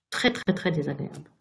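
ordinary delivery, nothing marked

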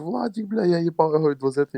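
mock-outraged neighbour; exasperated refusal.

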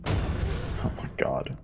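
slow, stunned whisper. Real, not acted. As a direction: breathe it out in disbelief. Tense moment in game.

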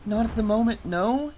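high-pitched, mildly desperate reply. A narrator's reading. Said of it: "Jess said desperately".

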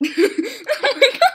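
high, loud, slow laughing; laughing oh my god. Real, not acted. Friend's answer sets off loud laughing. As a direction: stretch it out while laughing.

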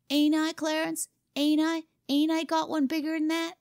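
put-on high, insistent child voice; boastful boy. A narrator's reading. Boy appeals to Clarence to back his boast, insistent. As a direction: press your sidekick to back you up, pitched high.

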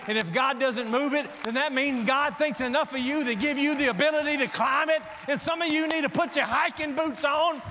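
loud, high, building to a shout; full-force preaching. Acted. Preacher urging congregation to climb their mountain, full-force preaching.

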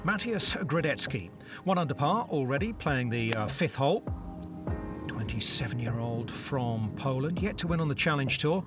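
hushed golf play-by-play; golf commentator. Real, not acted. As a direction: call the shot softly.

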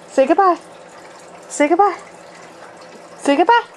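high, sing-song coaxing of a dog; high pet talk. Real, not acted.

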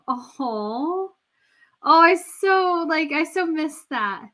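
normal talking voice

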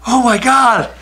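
high, loud panicked cry, half laughing; panicked shout. Retold or imitated. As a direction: yell the alarm like the driver just saw flames. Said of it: "He goes".

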